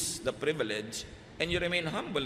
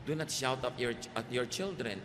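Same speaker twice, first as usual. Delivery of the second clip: usual speaking voice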